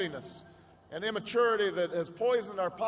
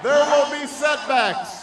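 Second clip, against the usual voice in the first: loud, slow and higher over the crowd; slow shout; 5 semitones higher, 9 dB louder, slower than usual. Real, not acted. President-elect warning the cheering crowd, slow loud shout. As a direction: call it out slowly over the noise.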